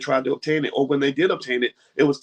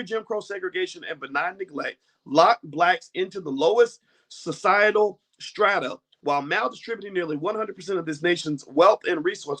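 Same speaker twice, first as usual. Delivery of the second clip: measured formal book reading; reading aloud; 5 semitones higher than usual. Acted. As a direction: read the passage out steadily. Reads book summary aloud.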